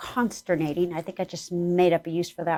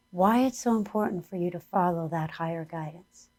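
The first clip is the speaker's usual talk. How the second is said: guided meditation; 3 semitones higher than usual. Acted. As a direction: lead the listeners gently inward.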